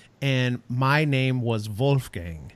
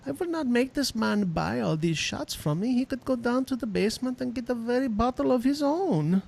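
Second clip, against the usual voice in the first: put-on higher, softer German-accented inner voice; practical German; 8 semitones higher, 5 dB quieter than usual. German bartender thinking to himself about a customer, German accent. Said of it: "German people are just so, like, uh".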